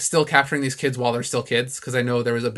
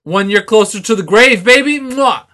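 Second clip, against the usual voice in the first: mock dramatic; 8 semitones higher, 10 dB louder than usual. Mock-dramatic joke about aging.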